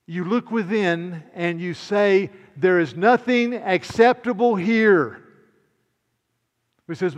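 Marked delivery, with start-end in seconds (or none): 0.0-5.2 slow, loud, ringing declaration; emphatic capitals. Shown as a demonstration. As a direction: read it out as if in capitals. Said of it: "and I put the caps".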